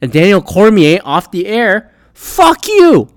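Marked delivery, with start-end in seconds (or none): 2.1-3.1 mock fuck-you. Mock insult at fighters off air.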